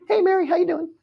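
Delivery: high, far-off sing-song hello; breezy greeting. Retold or imitated. Greeting Mary at Thanksgiving, a high breezy sing-song hello. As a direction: play a falsely casual cousin at Thanksgiving. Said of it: "And then you go".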